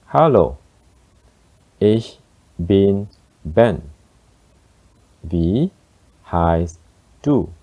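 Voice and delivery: slow and word by word for learners. Shown as a demonstration. Teacher slows down so learners can follow. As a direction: spell it out slowly for learners. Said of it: "This time, I'll speak slower".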